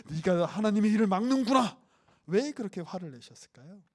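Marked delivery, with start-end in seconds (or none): 0.1-1.8 stern rebuke. Retold or imitated. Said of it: "안 했던 욕을 하셨어요".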